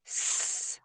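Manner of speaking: "S" as a long voiceless sss; isolated S sound. Shown as a demonstration. Teacher demonstrates the cedilla's sound, "S" as sustained sss. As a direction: hold "S" as a pure soft hiss, no vowel. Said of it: "on obtiendra le son".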